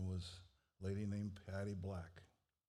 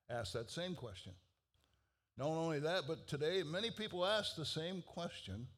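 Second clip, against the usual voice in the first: fervent preaching; 6 semitones higher than usual. Acted. Stand-in preacher to the congregation about Jesus, fervent preaching.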